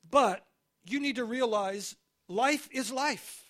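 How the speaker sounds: normal talking voice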